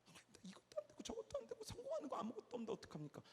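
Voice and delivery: fast, breathless whisper to God; whispered plea. Acted. Whispered helpless prayer to God. (하나님께 속삭이는 간절한 기도)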